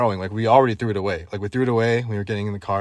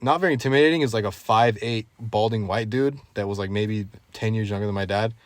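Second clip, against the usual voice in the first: normal talking voice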